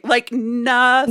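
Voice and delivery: slow, stretched "nothing"; drawn-out nothing. Real, not acted. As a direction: let "nothing" hang, very slow. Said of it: "drags out the word nothing".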